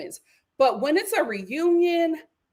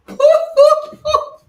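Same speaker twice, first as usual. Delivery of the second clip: loud, high whooping laugh; real high-pitched hoots; startled laughter; 12 semitones higher, 9 dB louder than usual. Real, not acted. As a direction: whoop it out after a fright. After the startled outburst.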